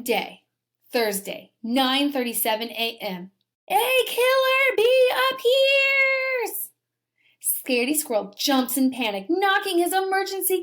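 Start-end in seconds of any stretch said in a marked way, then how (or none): 3.7-6.7 high, slow sung-out alarm cry; panicked alarm. A narrator's reading. Teacher reading to class, squirrel panics in a high wail.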